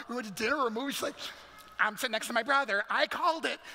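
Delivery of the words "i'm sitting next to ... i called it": put-on quick, loud kid calling dibs; mock childish shout, rising higher; little sister. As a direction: blurt out the claim like a child. Imitates little sister claiming seat.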